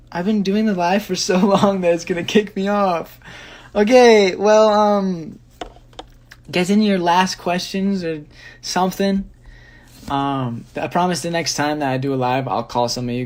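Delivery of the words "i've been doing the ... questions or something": loud, higher-pitched rush to wrap up; laughing alarm, loud and raised; urgent exclaiming. Real, not acted. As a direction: call out to viewers before time runs out. Hurries viewers before live cuts off.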